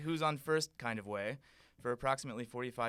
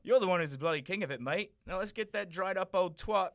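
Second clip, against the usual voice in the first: very loud, fast cockney; cockney Doctor; 5 semitones higher, 5 dB louder than usual. Acted. The Doctor offering to help the prince, in loud cockney. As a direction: boast in brash cockney.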